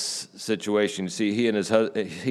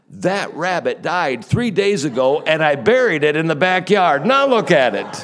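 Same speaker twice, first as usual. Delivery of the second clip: higher, louder, alarmed shout; shocked outburst; 7 semitones higher, 5 dB louder than usual. Retold or imitated. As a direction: blurt it out in disbelief. Neighbor's shocked punchline reveal.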